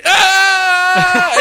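very high, loud scream; mock scream. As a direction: scream instead of saying goodbye. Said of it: "I'm just gonna yell every time".

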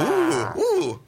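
high, playful shivery "ooh"; very high-pitched mock shudder. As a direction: coo as if getting the shivers. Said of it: "that makes me all shivery".

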